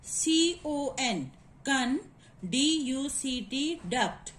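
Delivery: "C O N, con, D U C T, duct" slowly, letters then syllable; spelt out. Shown as a demonstration. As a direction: spell each half of conduct, then say it. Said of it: "now come to the next word".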